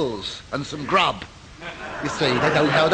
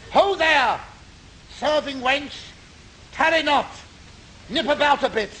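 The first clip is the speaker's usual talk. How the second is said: high, hammy lordly hollers; 8 semitones higher than usual. Acted. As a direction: bellow for the serving wench. Calling out for the barmaid.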